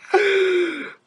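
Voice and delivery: loud, high-pitched burst of laughter; high mock laughing fit. Viewer reacting to the villain's screaming, high laughing fit. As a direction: burst out in a high, incredulous cackle.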